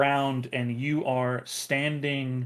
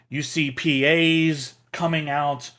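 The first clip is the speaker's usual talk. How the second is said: ordinary talk, nothing marked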